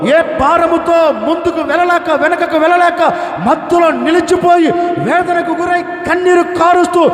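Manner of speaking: high, loud, building cry; shouting. Acted. Pastor shouting over the congregation's suffering, rising. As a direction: let it swell into a shout.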